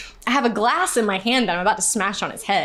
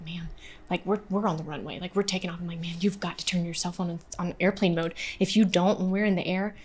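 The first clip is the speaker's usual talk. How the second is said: low, hushed retold warning; soft, low aside to a seatmate; discreet warning; 3 semitones lower, 8 dB quieter than usual. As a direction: quietly urge a stranger, voice dropped.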